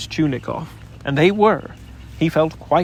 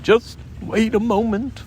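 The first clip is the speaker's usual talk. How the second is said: nervous Tigger; 7 semitones higher than usual. A narrator's reading. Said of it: "said Tigger nervously".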